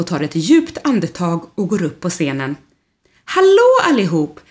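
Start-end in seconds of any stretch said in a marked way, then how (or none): 3.2-4.4 high, loud stage welcome; cheerful host greeting. Acted. Rodolfo welcoming the crowd from the stage, loud and high. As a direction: greet the whole hall like a host. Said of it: "säger han glatt".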